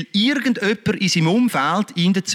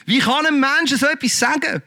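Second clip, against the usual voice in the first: retold indignation; 7 semitones higher than usual. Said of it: "Und der hat mich so aufgeregt".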